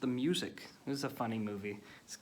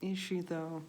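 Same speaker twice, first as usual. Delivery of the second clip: higher, sceptical "is she, though"; skeptical doubt; 7 semitones higher than usual. Retold or imitated. Reviewer doubting the hype about an actress, sceptical. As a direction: raise an eyebrow and doubt it. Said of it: "I'm like".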